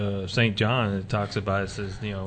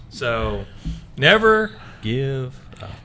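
ordinary talk, nothing marked

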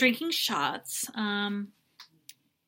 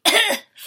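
mock gagging cough; 11 semitones higher, 6 dB louder than usual. Imitating him vomiting.

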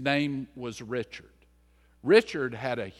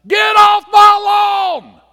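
shrieked high and slow; cranky neighbor screaming at kids to get off his lawn; angry scream; 17 dB louder, slower than usual. Retold or imitated. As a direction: yell like a furious old neighbor. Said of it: "would stand out and scream at us".

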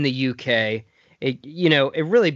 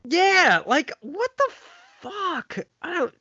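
much higher pitched, then trailing off; incredulous, fed up; exasperated disbelief; 12 semitones higher than usual. Real, not acted. Agreeing with co-host about the rushed scene, exasperated high disbelief.

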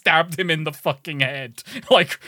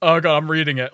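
ordinary talk

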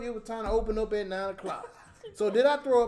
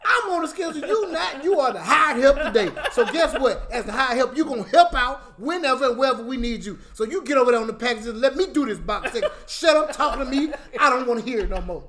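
louder, rising angry rant with laughter breaking in; Kevin Hart rant; 3 semitones higher, 7 dB louder than usual. Real, not acted. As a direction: tell her off, getting worked up. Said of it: "I'm getting a little angry right now".

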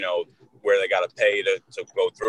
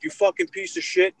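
high-pitched outraged accusation; retold furious tirade, pitched up high; imitated angry yelling; 8 semitones higher, 4 dB louder than usual. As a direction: imitate an irate father yelling at someone. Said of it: "the dude's yelling at some other dude".

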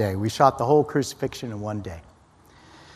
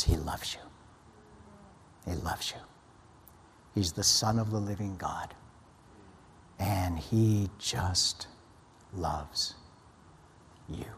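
tender and hushed; 4 semitones lower, 14 dB quieter than usual. Retold or imitated. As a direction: breathe it out as a confession of love. Writing his journal, deeply moved.

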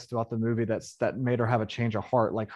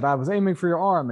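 lightly voiced shooter; 6 semitones higher, 4 dB louder than usual. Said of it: "she said".

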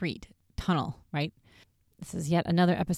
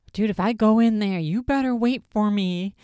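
louder, higher scared pleading; imitating a jumpy friend, raised and pleading; mock nervous friend; 5 semitones higher, 6 dB louder than usual. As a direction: ham up the nervous demand. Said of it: "And Aaron is like".

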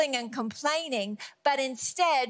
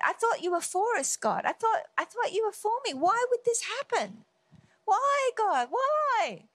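higher-pitched mock plea to God; pleading complaint; 8 semitones higher than usual. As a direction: protest upward, hurt and baffled. Voices believer complaining to God.

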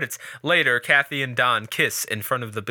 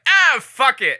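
exasperated; 11 semitones higher, 8 dB louder, slower than usual. Retold or imitated. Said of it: "just was like".

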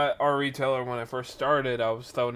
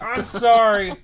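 high, loud mock disbelief; incredulous; 8 semitones higher, 9 dB louder, slower than usual. Worker reacting to lady's admission about school, incredulous. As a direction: burst out as if you can't believe it.

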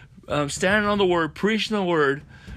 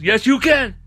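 loud, raised retort; emphatic rebuttal; 6 semitones higher, 6 dB louder than usual. Real, not acted. Preacher rebutting a religious doubter, loud emphatic retort. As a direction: fire it back high and loud.

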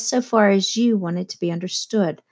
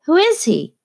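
higher, louder pressing question; demanding; 9 semitones higher, 4 dB louder than usual. A narrator's reading. Narrator pressing Jordan about their mysterious host, demanding. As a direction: demand an answer, a touch louder. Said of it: "I demanded".

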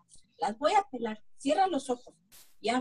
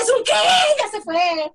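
ordinary talk

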